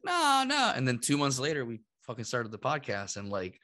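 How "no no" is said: mock refusal. Retells brushing off podcast idea.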